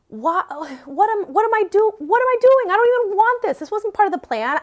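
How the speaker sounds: retold panic at your own actions; bewildered self-questioning. Asking herself what she is doing at the dispensary, bewildered.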